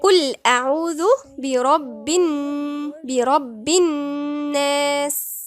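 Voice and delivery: slow, level Quranic recitation; ghunnah recitation. Shown as a demonstration. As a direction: hold a nasal hum on the ن of الناس, then stretch the long vowel. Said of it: "يغن بمقدار حركتين".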